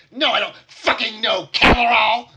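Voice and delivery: high, loud snarled no; spat furious denial. Acted. Goblin spits her denial at the questioning gnome, furious. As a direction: spit the denial out in rage. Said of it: "spit at you".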